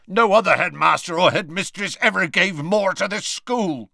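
loud rough growl, cutting in. Acted. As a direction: growl it out over her. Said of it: "growled Hagrid".

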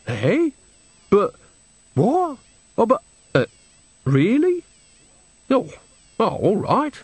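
high, halting bewilderment; baffled, catching on. Acted. Nanny slowly takes Granny's hint, baffled and halting. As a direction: stumble through it in rising puzzlement.

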